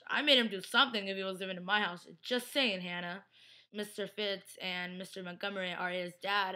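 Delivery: ordinary talk, nothing marked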